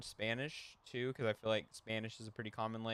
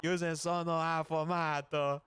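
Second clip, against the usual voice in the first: mock outraged waiter; 5 semitones higher, 3 dB louder than usual. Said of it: "He's like".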